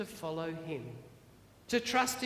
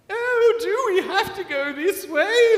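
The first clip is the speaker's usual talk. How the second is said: much higher, loud, squeamish whine; fussy Lucy; 10 semitones higher, 11 dB louder than usual. Acted. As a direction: balk at the mud like a pampered car. Lucy balks at muddy water.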